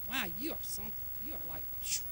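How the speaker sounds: low, hushed wonder; very soft, pretend-impressed aside; mock awe. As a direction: murmur it as if overawed. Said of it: "That sounds really spiritual".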